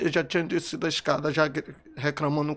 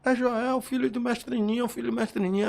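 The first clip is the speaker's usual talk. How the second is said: much higher, urgent retold cry; retold screaming mother-in-law; 8 semitones higher than usual. Mother-in-law shouting who was shot, high retold cry. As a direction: imitate her crying out his name. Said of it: "a sogra dele começou a gritar".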